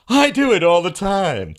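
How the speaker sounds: high, loud, slow smug mock voice; mocking Scott. Mocking the guest's claim, high, loud and slow. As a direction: stretch it out like a smug braggart. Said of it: "that was prejudicial mocking".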